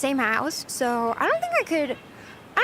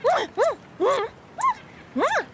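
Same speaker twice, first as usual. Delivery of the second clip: string of high, quick smooches; blown kisses; 10 semitones higher than usual. Pretend. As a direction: blow five kisses in a row. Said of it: "I will give you ten kisses".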